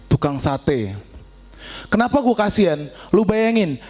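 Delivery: ordinary delivery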